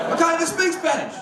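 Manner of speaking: normal talking voice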